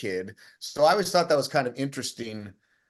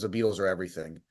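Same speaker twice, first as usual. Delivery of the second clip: lower, softer, a touch moved; 6 semitones lower, 4 dB quieter, faster than usual. Retold or imitated. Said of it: "he got, like, really emotional".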